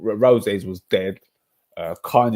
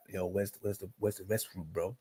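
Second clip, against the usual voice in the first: imitating [name]; 12 dB quieter than usual. [name] walking in on him, imitated quietly. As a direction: ask it softly, as if intruding. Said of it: "and he's like".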